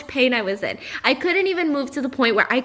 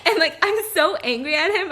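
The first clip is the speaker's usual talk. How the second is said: ordinary talk, nothing marked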